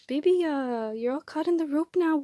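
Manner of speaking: high, loud alarmed cry to a pet; panicking over a tangled bird. Real, not acted. Bird caught in the rope.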